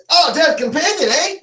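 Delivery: put-on high-pitched old man voice. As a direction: pipe up as a curious old codger. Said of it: "You see the old man".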